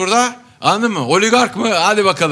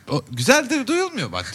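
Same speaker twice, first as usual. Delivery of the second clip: higher-pitched grumbling uncle; lightly voiced old man; 8 semitones higher, 3 dB quieter than usual. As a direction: imitate an elderly man lightly complaining. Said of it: "diyor ki yaşlı amca". (hafif yaşlı amca taklidi)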